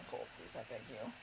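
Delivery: soft whispered begging; whispered plea. Real, not acted. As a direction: beg under breath. Pleading that film isn't four hours.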